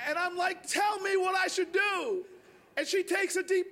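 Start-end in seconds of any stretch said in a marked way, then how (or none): 0.7-2.3 high, drawn-out begging; hammed-up impatience, pitched up; retold desperate plea. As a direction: plead slowly for the answer. Begging neighbor for the answer.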